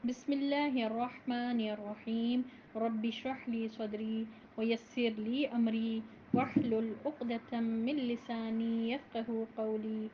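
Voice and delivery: slow, measured recitation of the opening prayers; formal Arabic recitation. Acted. (آہستہ، باوقار عربی تلاوت)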